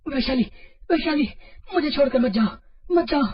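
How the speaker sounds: high, loud cry out of a nightmare; panicked scream. Acted. Anmol waking from a nightmare, screaming for Vaishali, panicked high scream. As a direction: scream her name, begging her not to go. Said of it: "वो चीखता हुआ जाग गया".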